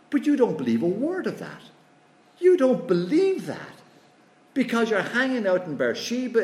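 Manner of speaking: preacher scolding hollow worshippers, loud and indignant; indignant rebuke. Acted. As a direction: rebuke them with building outrage.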